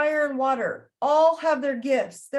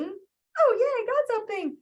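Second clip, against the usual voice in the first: high, giddy hammed-up delight; mock thrilled reward-seeker; 10 semitones higher than usual. Teacher mocking someone who got a ding, high giddy squeal. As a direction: squeal like you just won a prize.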